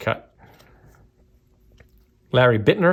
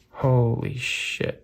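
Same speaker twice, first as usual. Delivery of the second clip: slow, much softer, low awe; awed hush; 3 semitones lower, 6 dB quieter, much slower than usual. Real, not acted. Collector staring at the centered card, softly awed. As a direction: let it out as a stunned breath of disbelief.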